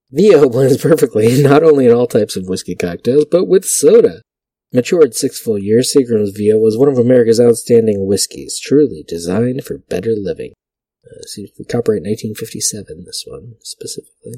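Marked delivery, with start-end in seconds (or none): 0.1-10.5 louder vintage announcer read. Acted. Vlogger reads an old whiskey ad aloud in announcer voice. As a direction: sell it like a fifties radio ad.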